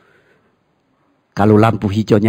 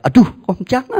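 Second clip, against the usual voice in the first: mock protest; 12 semitones higher than usual. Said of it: "Ada yang bilang".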